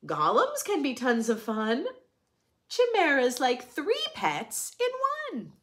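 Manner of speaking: lively, sing-song picture book reading; reading to children. A narrator's reading. Reading a rhyming picture book to children, sing-song. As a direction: read it brightly to the little ones.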